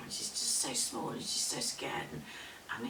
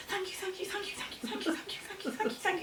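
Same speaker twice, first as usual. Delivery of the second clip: high, rapid-fire little voice; frantic thanks; 12 semitones higher, faster than usual. Acted. Fleeing woman to the forest as she runs, frantic. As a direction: squeak out hurried thanks.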